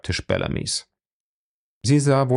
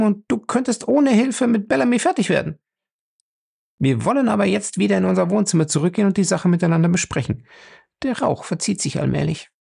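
put-on higher, quick, arguing young woman; Fay's voice; 7 semitones higher than usual. Fay reasoning with frightened Julius, high and quick. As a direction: talk him out of his fear, briskly.

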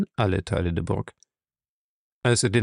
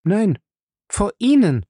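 high, loud cutting reply; sharp retort; 10 semitones higher, 5 dB louder than usual. Acted. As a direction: throw it back at him. Fay retorts.

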